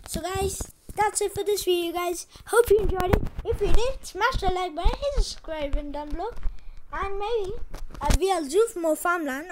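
loud, high-pitched YouTuber outro; excited sign-off. Real, not acted. Youtuber asking viewers to like and subscribe, excited sign-off. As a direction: project it with big, high energy.

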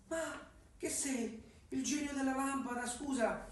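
woman's voice. Acted. Lucia answers the cat.

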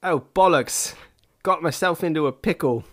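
high-pitched, badly faked British accent. Podcast host rues his pickle to co-host, bad British accent. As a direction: lament it in a hammed-up British voice. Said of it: "this poorly represented British accent".

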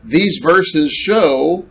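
"These verses show" drawn out slowly, rising at the end; slow, pointed quotation; slow, stressed quote. Shown as a demonstration. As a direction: stretch out "These verses show" as the missing phrase. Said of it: "notice what it does not say".

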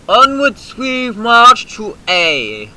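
slow, high, loud theatrical proclamation; mock march order. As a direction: lead the march like a general. Rallying cry.

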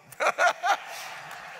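bright high burst of laughter. Real, not acted. Preacher laughing at his own joke to the congregation, a bright burst of laughter.

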